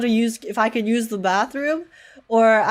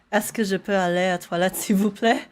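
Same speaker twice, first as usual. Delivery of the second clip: French phrase; 2 semitones lower than usual. Shown as a demonstration. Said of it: "only things I know in French are".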